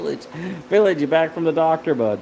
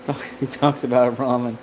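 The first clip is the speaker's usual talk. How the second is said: normal talking voice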